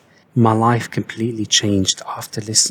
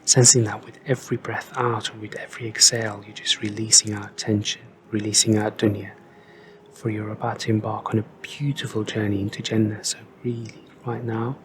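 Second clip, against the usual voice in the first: guided meditation; 3 dB quieter than usual. Real, not acted. Guiding listeners to breathe and picture Jannah, calm guided meditation. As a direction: talk them gently through each breath, unhurried.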